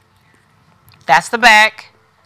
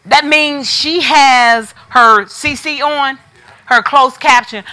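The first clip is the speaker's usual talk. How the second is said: ordinary talk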